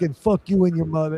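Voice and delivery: a hint of a New York rep cursing; mock New York insult. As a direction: fling the line like a rude New Yorker. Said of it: "with a New York accent".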